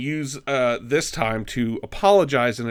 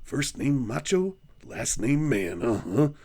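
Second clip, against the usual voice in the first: Macho Man voice; 5 dB quieter, slower than usual. Retold or imitated.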